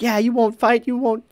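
high-pitched taunting jeer; mock egging on. Game master recalling a player egging brawlers on, mock taunting. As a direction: imitate a heckler goading a fight. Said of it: "trying to egg them on".

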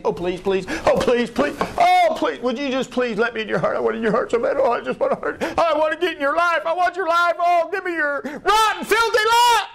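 high, pleading, mocking whine rising to a squeal; begging Jesus. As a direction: beg like a pitiful doorman at the door. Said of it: "just begging you".

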